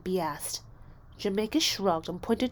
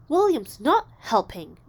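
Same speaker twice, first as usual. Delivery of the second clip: complaining; 3 semitones higher, 8 dB louder than usual. A narrator's reading. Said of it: "Jamaica complained".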